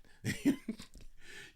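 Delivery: airy high-pitched chuckle; high breathy laugh. Real, not acted. Speaker laughing at his own retort, high airy laugh.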